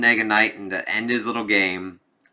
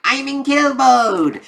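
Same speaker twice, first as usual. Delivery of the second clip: high, loud, drawn-out gleeful cry; shrieky mock-villain glee; 6 dB louder, slower than usual. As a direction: crow it with manic glee, way up high. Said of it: "gleefully being like".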